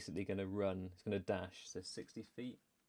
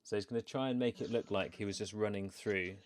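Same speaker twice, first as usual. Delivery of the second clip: ordinary talk, nothing marked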